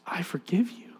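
breath-only whisper, soft; whispered forgiveness. Retold or imitated. Woman repeating her forgiveness to stunned dad, whispered. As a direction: whisper it tenderly as a retold line.

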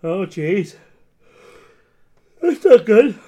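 talking through a yawn; stretched, yawny words. Real, not acted. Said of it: "I'm yawning over here".

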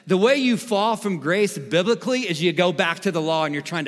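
high, loud, insistent sermon delivery; forceful preaching. Real, not acted. Pastor assuring listeners grace cannot be lost, forceful preaching.

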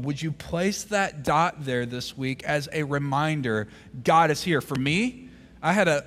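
rousing sermon delivery; full-force preaching. Real, not acted. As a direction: drive it home to the congregation.

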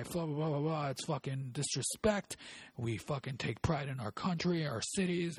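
high, indignant mock tirade; irate customer rant. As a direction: rant like an outraged patriot complaining to management. Acted irate customer rant to manager.

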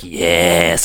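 very low, suggestive imitation; mock leering. Chet eyeing Scott up and down, mock leering. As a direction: drop it way down, imitating a lecherous flirt. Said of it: "kinda eyes him up, down, and licks".

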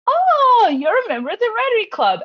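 high, loud gushing "oh"; mock thrilled discovery. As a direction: flatter them with hammed-up amazement. Acting out an eager opening gambit.

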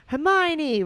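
high, loud call of her name; yell. A narrator's reading. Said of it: "Ron yelled".

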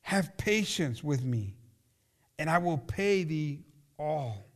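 measured, solemn Bible reading from the pulpit; scripture reading. Acted. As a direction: read the parable slowly and gravely. Reads the parable aloud.

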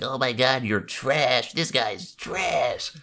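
high, drawn-out taunt; sneering chat troll; mocking troll. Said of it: "someone will be like".

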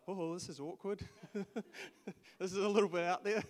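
laughing through mock embarrassment; awkward laugh. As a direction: giggle it out, playing the awkward crowd. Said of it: "everyone would sit there kinda like".

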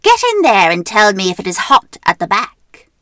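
loud bossy witch. Acted. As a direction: command like a witch. Witch orders Gretel.

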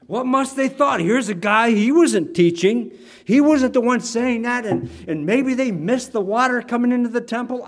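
high, loud, worked-up wondering; astonished onlooker. Acted. Preacher imagines what people thought on first hearing, astonished.

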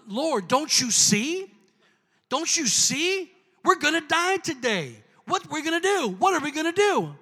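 freaked out servant. Retold or imitated. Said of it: "it freaked Gehazi out".

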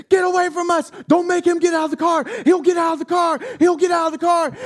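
imitating the woman shouting threats; threatening shout. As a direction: yell a warning that he'll come out. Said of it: "she's leaning across him".

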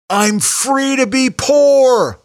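high, loud, drawn-out shout; drunken yell. Retold or imitated. Retelling drunkenly yelling at the fireworks crowd, loud and slow. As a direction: bellow it slowly like a drunk in a crowd. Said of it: "I was yelling during the fireworks".

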